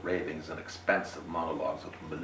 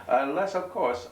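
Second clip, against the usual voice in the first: higher, polite gentleman voice; courteous, pitched up; 10 semitones higher, 4 dB louder than usual. Acted. Gentleman to the stranger.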